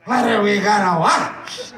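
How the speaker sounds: slow, exaggerated wife impression; imitating wife. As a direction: drag the question out like a nagging wife. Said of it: "Like that".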